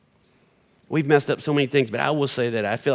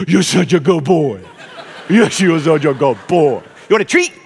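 talking to a good dog; 4 semitones higher, 9 dB louder than usual. Pretend. Wife praising husband like a Labrador, high doting dog talk. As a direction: gush over him like praising a Labrador. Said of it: "Think of him as a Labrador".

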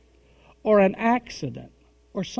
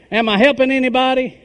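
calling out; 6 semitones higher, 8 dB louder, faster than usual. Real, not acted. Preacher calls out to crowd.

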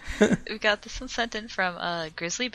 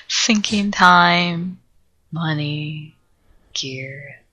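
each loss dropped slowly, going lower; slow grim list; 3 semitones lower, 6 dB louder, much slower than usual. Real, not acted. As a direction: spell out the doom word by word. Listing losses with dread.

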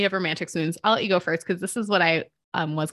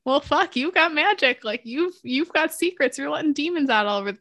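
higher, fast exasperated retelling; high-pitched mock exasperation; excited quote; 8 semitones higher than usual. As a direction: toss off the list of troubles in a rush. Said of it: "there's like".

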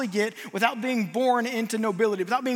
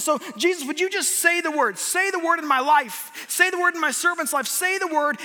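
fervent preacher's appeal, pitched up; impassioned plea; 3 semitones higher than usual. Acted. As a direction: implore with full conviction, driving each repeat. Preacher voices centurion's plea of faith.

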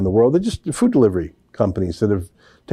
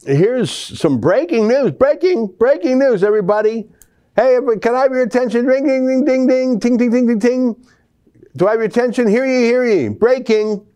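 town crier; 13 semitones higher than usual. Pretend. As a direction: announce it like a town crier calling the square. Mock announcement of breaking news.

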